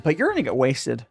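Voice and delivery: normal talking voice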